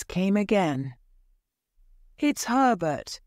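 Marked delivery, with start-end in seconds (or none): 2.2-3.2 high, urgent cry of hope; a mother sure her son is back; excited cry. A narrator's reading. Wife cries to husband, high and urgent. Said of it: "she cried".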